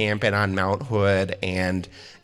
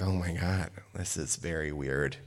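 hushed aside; 4 semitones lower, 10 dB quieter than usual. Retold or imitated. Awkward at the funeral.

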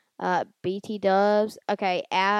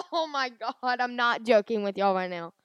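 ordinary talk, nothing marked

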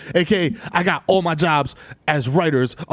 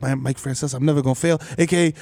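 ordinary talk, nothing marked

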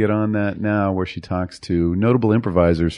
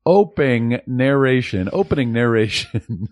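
ordinary delivery

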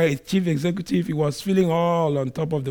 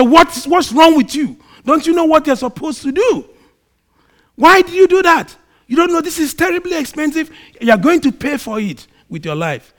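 retold furious outburst, much higher and louder; outraged car owner shouting at a boy; angry yelling; 10 semitones higher, 8 dB louder than usual. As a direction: berate the kid at the top of your voice. Rich man confronts stone thrower.

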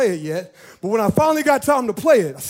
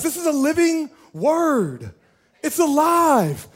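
usual speaking voice

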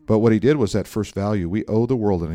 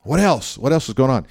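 raised, louder impatient prompting; aggravated; 4 semitones higher, 6 dB louder than usual. Retold or imitated. Pastor pushing his friend for the real news, aggravated. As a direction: press him with mock exasperation. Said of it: "I'm aggravated".

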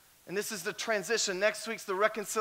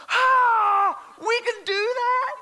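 high, loud, stunned cry of disbelief; amazed shout; 8 dB louder, much slower than usual. Retold or imitated. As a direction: shout it like a wide-eyed rookie. Rookie amazed at partner's takedown.

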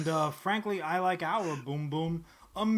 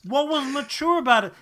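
loud, higher outraged question; indignant yelling; 6 semitones higher, 8 dB louder than usual. Real, not acted. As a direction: demand an answer, fed up. Ranting at Marvel's label.

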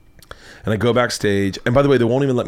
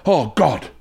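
higher, mildly dismayed groaning words; mock upset; 4 semitones higher than usual. British host hearing comic's teasing, mock upset. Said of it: "like, really upset".

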